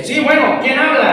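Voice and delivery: loud phone answer to an unknown caller. Retold or imitated. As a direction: call out sharply who's calling. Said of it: "todavía levantando más mi voz".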